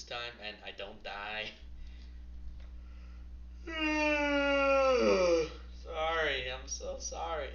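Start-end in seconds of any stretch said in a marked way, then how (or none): 1.6-5.8 long loud real yawn that rises to a groan; big yawn. Real, not acted. Tired streamer yawning loudly into his microphone. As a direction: stretch out a full noisy yawn. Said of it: "if I didn't yawn".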